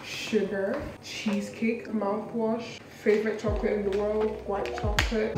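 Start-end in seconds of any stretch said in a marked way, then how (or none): none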